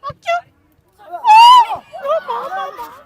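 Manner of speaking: excited cry. Real, not acted. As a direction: yell out in suspense. Tense moment near goal.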